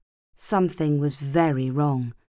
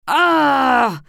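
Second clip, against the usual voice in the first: loud high wail of shame; anguished yell; 8 semitones higher, 7 dB louder than usual. Acted. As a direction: cry out in horror at yourself. Groan of shame.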